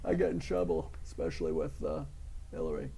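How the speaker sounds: lightly put-on public figure's voice; political celebrity impression. Impressionist answers host's request for a celebrity, lightly put-on voice. Trump impression after being asked.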